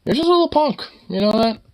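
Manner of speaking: very high, sing-song taunt; high teasing taunt. Real, not acted. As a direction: tease the little punk from up high. Teasing the pet.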